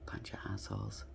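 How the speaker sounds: बुदबुदाकर फुसफुसाया गया ताना; whispered aside. Real, not acted. Muttered aside about the offenders.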